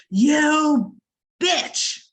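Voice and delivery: the man in the crosswalk yelling at the driver; loud yell. Retold or imitated. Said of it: "he yells out in loud, very loud voice".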